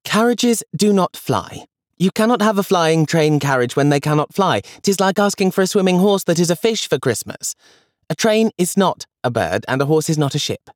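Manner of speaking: put-on high, know-it-all girl voice; older sister correcting her brother; child Julia. A narrator's reading. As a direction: play the exasperated elder sister, high and firm. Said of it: "said Julia".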